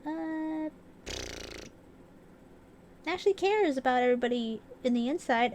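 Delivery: high, whiny voice of her hopeful past self; imitating her own naive hope, high and whiny; mock naive self. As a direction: play your old gullible self, pleading. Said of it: "I'm like".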